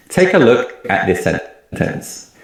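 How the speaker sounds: normal talking voice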